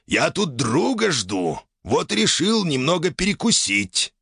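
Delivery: a hint of a life-worn elder; wise old man. A narrator's reading. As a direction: explain yourself as a genial old man. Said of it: "голосом умудрённого жизнью старика".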